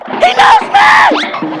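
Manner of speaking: very high, drawn-out ecstatic shriek; inner fan scream. Retold or imitated. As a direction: scream it with starstruck joy. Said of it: "in my head, I'm like".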